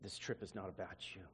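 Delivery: low, quiet, firm voice of God. Retold or imitated. God stops the crying preacher, low quiet command. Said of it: "the voice of God said".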